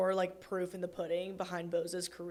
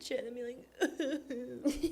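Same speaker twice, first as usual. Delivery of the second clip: laughing through the words; 5 semitones higher than usual. Real, not acted. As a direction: giggle while ranting.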